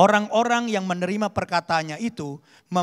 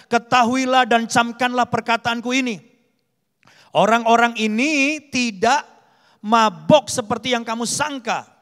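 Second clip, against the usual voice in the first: loud, higher-pitched proclamation; suara nyaring; 6 semitones higher, 10 dB louder than usual. A narrator's reading. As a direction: read it out ringing loud, like addressing a crowd. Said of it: "dengan suara nyaring ia berkata". (berseru dengan suara nyaring)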